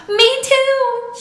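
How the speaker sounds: very high, loud squeal; a girl's thrilled shriek. A narrator's reading. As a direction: squeal with joy. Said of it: "she squealed".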